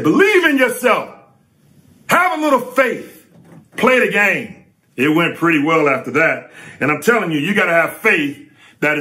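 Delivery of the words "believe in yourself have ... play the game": high, shouted coach's encouragement from the bench; coach screaming out. Retold or imitated. Coach yells encouragement to a scared rookie, high shout. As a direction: yell it like a coach pushing his rookie. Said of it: "Lenny screaming out".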